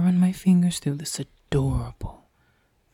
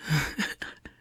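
soft whispered chuckle; 4 dB quieter than usual. Acted. Amused at denial.

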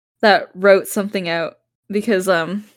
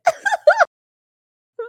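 ordinary talk, nothing marked

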